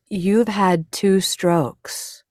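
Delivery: imitating careful doctors, each word stretched out; very slow, gentle. Doctors telling patient about strokes, very slow and gentle. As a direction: break it to a fragile patient very slowly. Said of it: "doing that same very slow".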